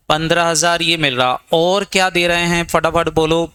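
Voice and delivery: normal talking voice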